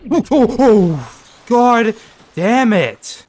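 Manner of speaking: loud, high, drawn-out yelp of fright; real jump scare shriek; startled scream. Real, not acted. Startled by piranhas in game.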